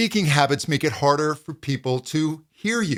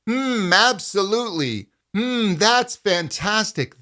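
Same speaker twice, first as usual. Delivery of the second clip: higher, energized speech; really energized; 6 semitones higher than usual. Shown as a demonstration. Coach shows energized optimal pitch, higher. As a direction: pump energy into each word. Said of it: "Something really energized".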